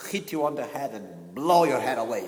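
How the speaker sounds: loud defiant challenge to anger; stern, forceful mock threat; acting angry. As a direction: shout down your own anger. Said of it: "really acting".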